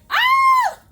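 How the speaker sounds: piercing high mock scream. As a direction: shriek like she just heard gunshots. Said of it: "She was like".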